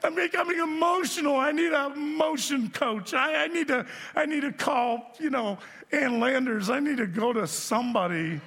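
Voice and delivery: high, drawn-out comic wail; mock bawling. Sixty-one-year-old moviegoer berates himself, in a mock tearful wail. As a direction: overwrought wailing, hammed up for laughs. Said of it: "I'm bawling".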